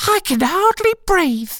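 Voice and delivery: very high squeaky creature voice; complaining Dormouse. Acted. Dormouse squeezed by Alice, high squeaky complaint. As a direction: squeak it as if squashed.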